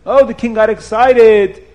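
ordinary delivery

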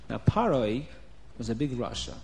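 ordinary delivery, nothing marked

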